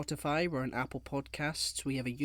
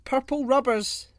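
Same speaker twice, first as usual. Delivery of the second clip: higher, louder and slow; comic outburst; 10 semitones higher, 10 dB louder than usual. Real, not acted. Loudly blurting a random joke to end the segment. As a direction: land the silly non sequitur hard.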